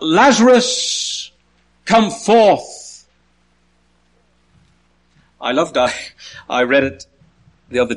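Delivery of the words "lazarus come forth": high, drawn-out commanding call; loud call. Acted. Jesus calling Lazarus out of the tomb, loud and drawn out. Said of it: "in a loud voice, he calls out".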